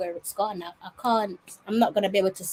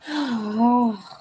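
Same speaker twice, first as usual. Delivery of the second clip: heavy sigh; 3 semitones higher than usual. Real, not acted. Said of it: "That has ruined my whole night".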